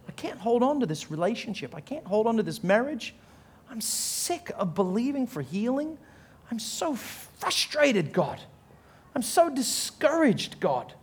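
high, strained-sounding complaint to God, acted; frustrated plea. Worn-out believer telling God they can't hold on, acted frustration. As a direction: draw out the frustration, pleading upward.

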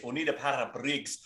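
rough go at a Scottish accent. Shown as a demonstration. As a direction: do a quick mock Scottish line. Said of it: "I gotta do this phrase".

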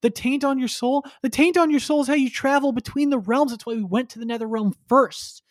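high-pitched, hammed-up disbelief at a dumb mistake; exasperated scolding. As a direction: play the fed-up mentor lecturing a dimwit. Damashi scolds Shujinko's mistake.

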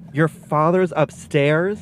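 mock outrage as the penny drops; stunned disbelief. Mom hearing dad slept upstairs, stretched-out shocked disbelief.

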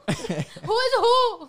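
high, very slow, laughing; laughing mock question. Friend finishing movie gag, slow laughing whine. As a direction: drag it out while laughing. Quoting Rush Hour who scene.

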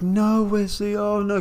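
hammed-up horror at what Wesley has done; mock dismay. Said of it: "they're all like".

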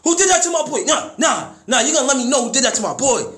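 high, loud, fast angry father; furious dad voice. Retold or imitated. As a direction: play the dad who won't have it, shouting about his son.

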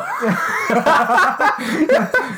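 high shrieking laugh, real. Real, not acted. Friend cracking up at a dirty joke, shrieking laugh.

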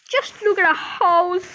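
slow, high, loud lament; distressed cry. A narrator's reading. Father sees the wrecked house, crying out in dismay. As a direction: wail it slowly over the damage. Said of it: "cried poor Mister Bucket".

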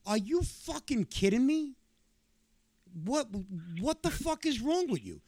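put-on yelling at the kid in disbelief, half laughing; retold furious yelling. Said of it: "I scream bloody motherfucking murder".

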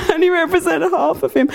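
high laughing mock complaint. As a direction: giggle through the words. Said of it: "walking around going".

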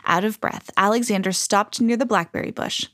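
ordinary delivery

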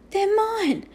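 high, distressed cry of denial; gasped protest. A narrator's reading. Boy defending his own toys to shopkeeper, gasping. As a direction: pitch it right up, struggling to get it out. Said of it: "Omri managed to gasp".

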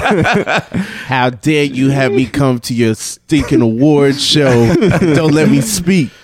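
slow, indignant award show outburst; slow mock outrage at being denied the mic. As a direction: ham up a wounded star's complaint. Mocking [name] award show rant.

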